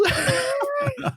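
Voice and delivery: high cracking laugh. Real, not acted. Laughs at own accent.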